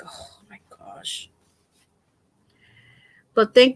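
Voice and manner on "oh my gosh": slow, barely voiced whisper; whispered dismay. Patient reacting to being sent to physical therapy, whispered. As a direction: breathe it out slowly, as if defeated. Retold dismay at doctor's order.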